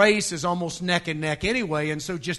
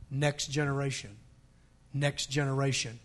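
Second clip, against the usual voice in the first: low, soft repetition with pauses; hushed emphasis; 7 semitones lower, 9 dB quieter than usual. Real, not acted. Preacher dwelling on the next generation, hushed emphasis. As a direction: lower the voice and let each repeat settle. Preacher repeating the key phrase.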